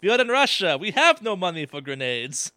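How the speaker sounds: mock Russian soldier; lightly put-on Russian accent. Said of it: "'cause it was like".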